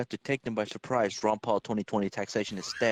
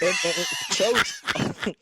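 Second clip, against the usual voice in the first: drawn-out mock shout, raised pitch; slow hammed-up yell; 8 semitones higher, 6 dB louder, slower than usual. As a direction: belt out the word chodes for a laugh. Mock dramatic shout of a word.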